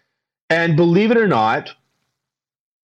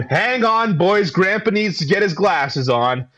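higher, lightly voiced old grandpa; rambling grandpa story; 6 semitones higher, slower than usual. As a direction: play a doddering old grandpa. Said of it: "I turned into Ken Campbell".

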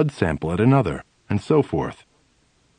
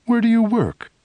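mock cheery psychiatrist; 13 semitones higher than usual. Voicing the psychiatrist's question.

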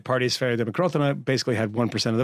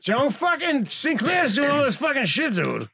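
put-on much higher, shouted Boston accent; shouting Boston accent, hammed up; slower than usual. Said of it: "You mean Ed Markey accent?".

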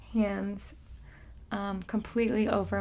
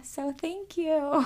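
tender thanks; 7 semitones higher, slower than usual. Real, not acted. Thanking emotionally.

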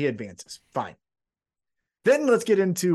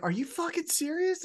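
incredulous; 11 semitones higher, 4 dB quieter than usual. Retold or imitated. Angry retold wrestling quote.

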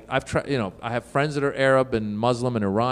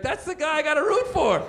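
put-on high-pitched, thrilled young fan; excited fan. Boy watching wrestling on TV, high excited cheer. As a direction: cry it out like a new convert.

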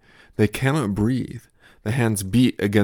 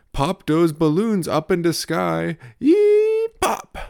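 put-on much higher, slow toddler voice ending in a squeal; toddler at play; 9 semitones higher, 5 dB louder, slower than usual. Toddler narrating his balloon game, high playful squeal. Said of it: "my toddler's play".

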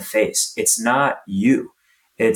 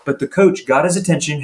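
usual speaking voice

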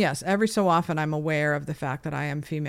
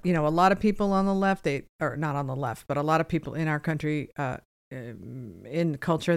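normal talking voice

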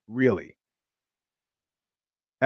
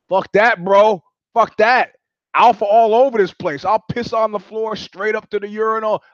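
higher, louder macho boast; mock alpha bravado; 6 semitones higher, 4 dB louder than usual. Boasting to the guys at the urinal, mock macho shout. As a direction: puff up like the alpha male.

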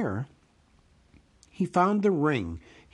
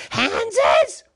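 high, loud creature screech; Gollum's creature voice; 8 dB louder than usual. A narrator's reading. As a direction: screech it as an eager guess. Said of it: "said Gollum".

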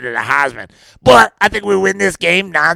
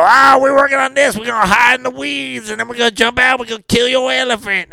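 higher, eager blurt, first line shouted; 7 semitones higher than usual. Retold or imitated. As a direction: blab the whole plan out excitedly. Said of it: "I go".

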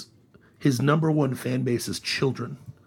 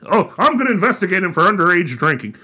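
mocking Ted Cruz; 7 semitones higher, 7 dB louder than usual. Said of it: "it's all show no go".

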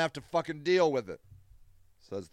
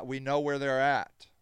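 usual speaking voice

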